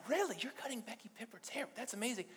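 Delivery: high, hushed disbelief; imitated amazement. Brother learns whose hair she cuts, amazed.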